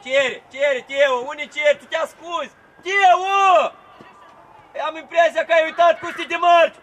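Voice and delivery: very loud, high-pitched berating; scolding shout. Real, not acted. Coach berating Teo for hiding, exasperated yell. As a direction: yell reproach across the pitch.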